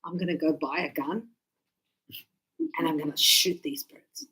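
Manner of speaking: mock threat. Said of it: "So sometimes I go".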